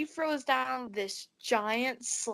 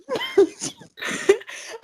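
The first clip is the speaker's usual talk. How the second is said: squealing laugh; high shrieking laugh; 7 dB louder than usual. Real, not acted. Bursts out laughing at the wheel.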